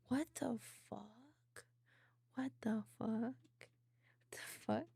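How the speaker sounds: very soft, half-whispered disbelief; stunned whisper. Real, not acted. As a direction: whisper it, baffled. Stunned reaction while watching.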